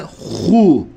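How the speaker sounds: hu with a rasping back-of-throat kh. Shown as a demonstration. Showing learners the thick letter, rasping hu. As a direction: scrape the h of hu, thick U after it. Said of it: "kırıltılı hu".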